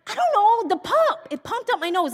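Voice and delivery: high-pitched exasperated retort; annoyed retort. Retold or imitated. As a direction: retell it annoyed, pitch jumping up. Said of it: "so annoyed that he cannot figure out".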